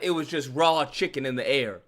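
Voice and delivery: normal talking voice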